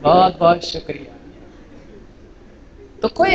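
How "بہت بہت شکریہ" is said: 1 soft slow thanks. Shown as a demonstration. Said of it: "repeat of thanks".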